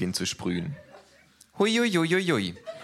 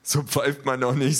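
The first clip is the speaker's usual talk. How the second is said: slow, amused brute voice; amused big thug; 8 semitones higher, 6 dB louder than usual. A narrator's reading. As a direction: scoff at the kangaroo. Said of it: "sagt der Große belustigt". (belustigter, langsamer Schläger)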